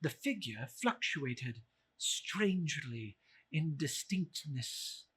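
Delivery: usual speaking voice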